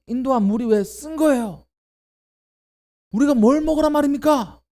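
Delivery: high, loud whining grievance; angry complaint. Acted. Israelites grumbling at Moses about bitter water, loud angry complaint. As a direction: rail at Moses like a thirsty crowd. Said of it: "원망하며".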